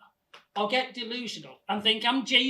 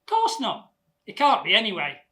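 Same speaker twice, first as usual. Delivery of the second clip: loud, certain dismissal; emphatic retort; 9 dB louder than usual. Acted. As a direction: dismiss it loudly and with conviction. Acted emphatic retort about being Jesus.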